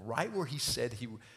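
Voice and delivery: normal talking voice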